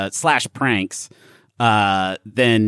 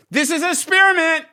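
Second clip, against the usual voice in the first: called out accusation. Pretend. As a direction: fire it off at the room. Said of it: "blank fire".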